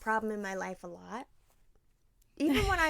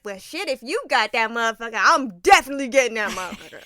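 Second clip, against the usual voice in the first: louder hyped-up dad voice; loud excited; 2 semitones higher, 9 dB louder than usual. Retold or imitated. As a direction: belt it like a fired-up dad.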